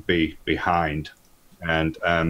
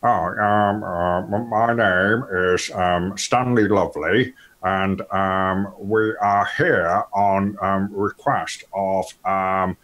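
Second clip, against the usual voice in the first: slow, lightly voiced polite gent; a hint of a prim gentleman introducing himself; posh gentleman voice; 2 semitones higher, slower than usual. Studley introducing himself to Gambetti, mild posh voice. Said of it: "and he says".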